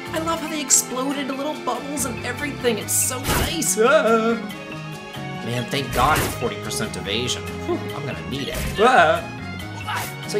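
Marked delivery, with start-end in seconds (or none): 0.1-3.8 high, excited squeal of enthusiasm; gushing delight. Real, not acted. Gushing over exploding enemies. 3.8-4.4 loud, high "ah" cry; combat yell. Real, not acted. Hit during game combat. 8.8-9.3 short, loud, higher cry; combat yelp. Real, not acted. Hit during game combat.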